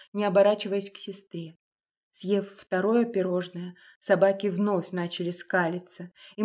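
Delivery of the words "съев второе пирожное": ordinary delivery, nothing marked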